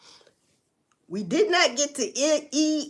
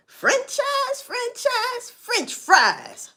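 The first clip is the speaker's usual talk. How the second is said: high mocking sing-song, drifting into French fries; high mocking imitation of the shop owner; mock cookie daddy; 10 semitones higher, 3 dB louder, much slower than usual. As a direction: parody the owner's answer. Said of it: "the damn cookie daddy".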